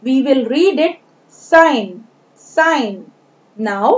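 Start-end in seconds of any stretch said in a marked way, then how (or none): 1.4-3.0 "sign" with no G sound; silent G. Shown as a demonstration. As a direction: model "sign", going straight to the N. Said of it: "G is a silent letter here".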